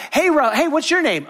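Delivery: lightly voiced eager networker; eager networking guest. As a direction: play a keen stranger saying hello.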